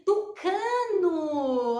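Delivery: tucano slow, with long vowels; drawn-out word. Shown as a demonstration. Teacher introducing a word with tu, drawn out. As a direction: stretch tucano smoothly without breaking it. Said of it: "com tu eu posso escrever".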